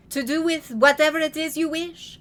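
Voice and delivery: put-on loud, grand old lady; Grandmère voice. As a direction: lay it on like a dramatic grandmother. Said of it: "Grandmère said".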